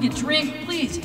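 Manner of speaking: ordinary talk, nothing marked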